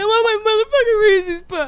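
high whining tantrum voice with fake crying; mocking a friend's cereal tantrum, high crying whine. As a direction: bawl it like a spoiled toddler. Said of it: "throwing a temper tantrum".

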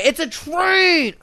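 high, drawn-out incredulous cry; exasperated disbelief. Real, not acted. Host baffled the girls didn't hear it, exasperated disbelief. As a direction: stretch "train" high in disbelief.

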